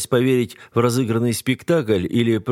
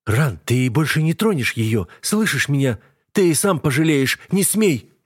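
rising, higher and higher desperate cry; breathless shout; 6 semitones higher than usual. A narrator's reading. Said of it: "задыхаясь, кричит Даян".

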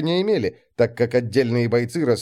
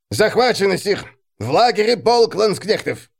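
urgent report; 6 semitones higher than usual. Acted. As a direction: report the bad news urgently. Messenger reports alarming news.

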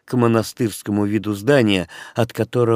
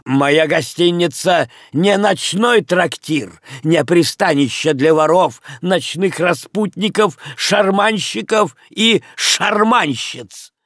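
выше и медленнее, с негодованием; бросает оскорбления, всё выше к концу; возмущённый крик; 6 semitones higher than usual. Acted. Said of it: "крикнул в ответ хозяин". (хозяин гостиницы бранит постояльцев, негодуя)

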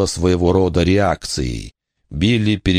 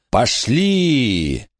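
shout; 6 semitones higher than usual. A narrator's reading. Scream as ghost touches.

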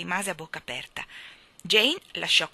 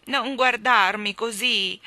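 slow, louder whine; whining; 4 semitones higher, 8 dB louder, slower than usual. Acted. Boy whining at his nanny's ironic look. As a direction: plead with a sulky whimper. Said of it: "piagnucolò Michael".

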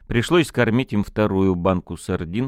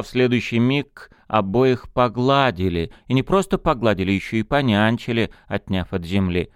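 ordinary delivery

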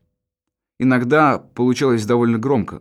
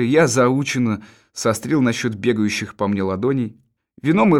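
ordinary delivery, nothing marked

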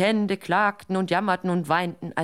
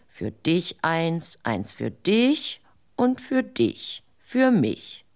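slow counting out; much slower than usual. Acted. Dunce dividing rabbits slowly.

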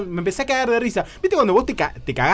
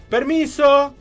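high, louder polite call; 9 semitones higher, 5 dB louder, much slower than usual. Retold or imitated. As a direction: ask permission to come in. Pide permiso al entrar. (pedido de permiso agudo)

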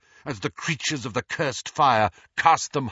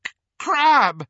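strangled call, much higher and louder; choked cry; 13 semitones higher, 7 dB louder than usual. A narrator's reading. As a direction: cry out for the lost friend, choking. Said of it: "choked Malfoy".

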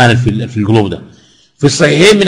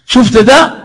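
slow amused laugh through the question; 8 semitones higher, slower than usual. Real, not acted. Preacher to the congregation, laughing at the grudge-holder. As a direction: chuckle as you ask it.

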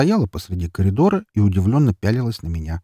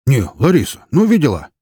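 indignant, louder and higher complaint; disgruntled grumbling; 6 semitones higher, 6 dB louder than usual. A narrator's reading. Neighbour complains to Лариса about the lad, disgruntled. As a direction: grumble with open disapproval. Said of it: "недовольно проворчал мужик".

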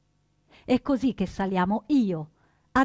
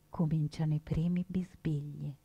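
whispered, hushed after the blast; 4 semitones lower, 7 dB quieter than usual. A narrator's reading. Narrator after the explosion, whispering. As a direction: barely breathe the words.

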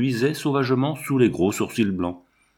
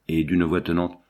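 ordinary delivery, nothing marked

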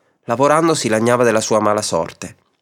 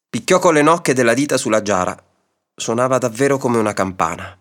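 ordinary delivery

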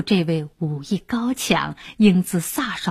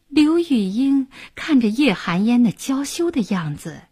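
ordinary delivery, nothing marked

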